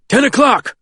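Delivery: high-pitched call-out; urgent cry. A narrator's reading. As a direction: call out a sighting to the crew. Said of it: "cries Carl".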